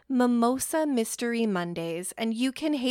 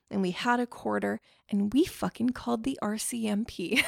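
ordinary talk, nothing marked

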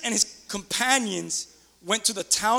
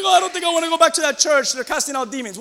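lightly voiced worried church-goer, higher pitch; mock church-goer; 6 semitones higher, 5 dB louder than usual. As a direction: put on a mild objecting voice, raised. Voices a church-goer's objection.

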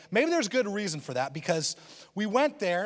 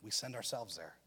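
much lower and softer, the point sinking in; hushed; 8 semitones lower, 11 dB quieter than usual. Shown as a demonstration. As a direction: let the line drop low and quiet. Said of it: "God doesn't send anybody".

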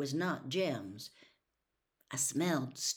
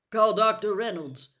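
loud, urgent order; sharp command; 4 semitones higher, 10 dB louder than usual. A narrator's reading. Father orders a doctor called for his hurt son, sharp and loud. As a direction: bark it down the hall. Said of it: "Atticus's voice came sharply".

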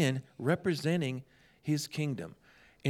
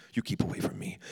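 hissed low and breathy, no voice; mock hissed warning; 4 semitones lower than usual. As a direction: push him away in a half-whisper. Injured man refusing prayer.

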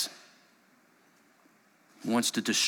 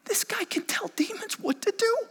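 much higher amazed onlookers; astonished crowd. Acted. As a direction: gasp it out like a stunned bystander. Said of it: "And they say".

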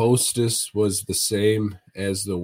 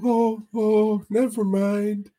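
much higher, goofy imitated friend's voice; Mahoney impression; 10 semitones higher than usual. Friend ordering appetizers from the waitress, high goofy imitation. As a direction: mimic a buddy's high, hesitant ordering voice. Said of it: "He's like".

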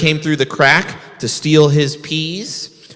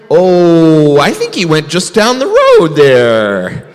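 louder, pitched-up put-on frog voice; disguised frog voice; 3 semitones higher, 5 dB louder, slower than usual. Rabbit hiding in mud answers bear in frog voice. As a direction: fake a froggy voice to fool the bear.

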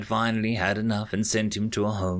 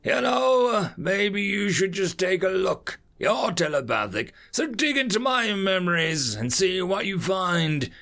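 put-on higher-pitched, insistent old man; feisty old veteran; 7 semitones higher than usual. Old veteran dares telepathic alien to read his memories, defiant. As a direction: challenge them in a raised old-timer's voice.

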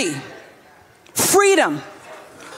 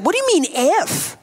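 incredulous Jesus; 2 semitones higher, faster than usual. Retold or imitated. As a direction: question the doubt quickly. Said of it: "he's like".

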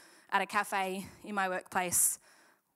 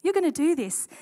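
mock cheerleader; 7 semitones higher, 8 dB louder than usual. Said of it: "He didn't go".

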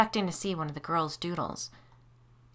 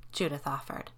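ordinary delivery, nothing marked

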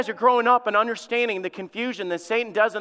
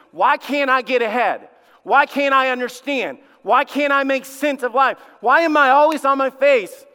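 raised, higher pleading questions; frustrated cry; 5 semitones higher, 6 dB louder than usual. Pretend. Preacher voices frustrated parents crying out, louder and higher. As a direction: cry out as someone stuck in life. Voicing frustrated people's cries.

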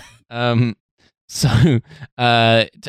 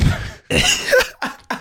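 squeaky laugh. Real, not acted. Laugh during impression.

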